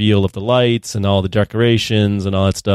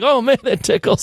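goofy high voice; 14 semitones higher than usual. Retold or imitated. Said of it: "and he's like".

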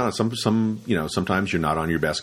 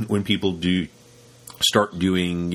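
normal talking voice

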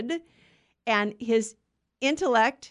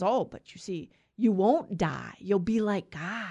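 sly hushed serpent voice, whispered; tempting devil whisper; 2 semitones lower than usual. Acted. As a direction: lure her in with a hiss-free whisper.

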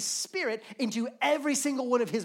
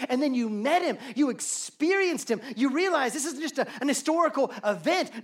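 preaching; 3 semitones higher than usual. Acted. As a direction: preach it with growing fire. Preaching builds to climax.

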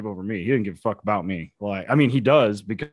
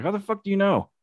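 mock disbelief, pitched well up; high incredulous; 12 semitones higher than usual. Retorting to handler who can't see dog, high incredulous. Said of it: "I'm like".

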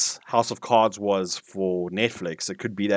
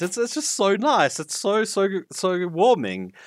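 much higher, gushing, slowing on "so warming"; gushing delight; 10 semitones higher than usual. Real, not acted. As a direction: melt with delight over the voice. Gushing over narrator's accent.

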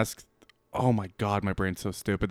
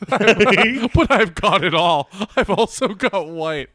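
laughing mockery; 7 semitones higher, 4 dB louder, slower than usual. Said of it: "mocking his reply".

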